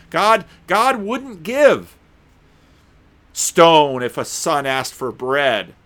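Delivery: slow, drawn-out emphasis; slow, weighty preaching; slow emphatic. Real, not acted. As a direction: lean on each word slowly.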